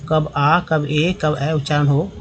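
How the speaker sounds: usual speaking voice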